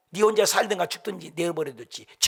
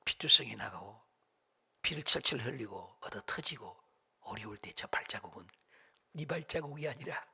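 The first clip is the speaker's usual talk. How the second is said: hushed, tender fatherly reply; the Lord's loving answer; 3 semitones lower, 10 dB quieter than usual. Retold or imitated. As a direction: speak softly and low, with pauses between phrases. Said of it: "주님이 걸걸걸 웃으면서 얘기했다잖아요".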